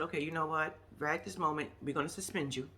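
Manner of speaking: low, quiet and understated; simply inform them, no drama; matter-of-fact suspension notice. Shown as a demonstration. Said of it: "You shoulda just said".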